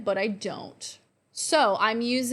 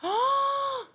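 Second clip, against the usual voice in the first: shocked gasp. Real, not acted. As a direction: gasp at the sight in the mirror. Seeing the finished lip look.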